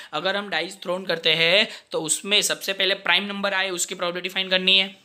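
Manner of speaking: ordinary talk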